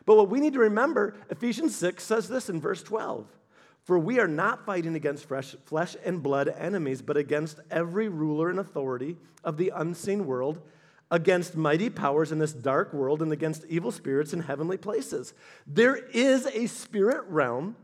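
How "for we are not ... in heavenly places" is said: lower, softer reading voice, each phrase laid down; scripture reading. Real, not acted. Preacher reading Ephesians to the church, in a lower, softer reading voice. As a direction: read it like holy text to the congregation.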